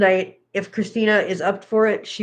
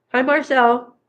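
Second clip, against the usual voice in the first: higher-pitched hello to a pet; pet greeting; 5 semitones higher, 4 dB louder, slower than usual. Real, not acted. Owner greeting Marcel the pet, higher-pitched.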